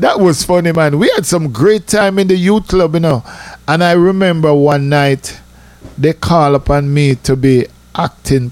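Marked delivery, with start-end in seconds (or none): none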